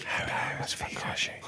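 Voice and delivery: breathy unvoiced whisper. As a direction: whisper it off mic. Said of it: "I hear you whisper silently off mic".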